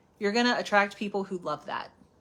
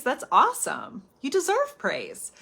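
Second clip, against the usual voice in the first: normal talking voice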